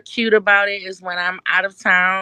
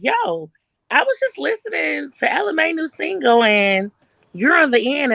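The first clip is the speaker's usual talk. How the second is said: higher-pitched eager fan voice; mildly hyped retold message; excited fan; 7 semitones higher than usual. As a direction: act out a fan with news. Acts out fans' messages.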